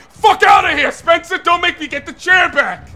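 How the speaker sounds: loud, high-pitched angry shouting; real gamer rage at full volume; angry threat. Real, not acted. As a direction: yell a furious warning at the traitor. Yelling at traitorous teammate in game.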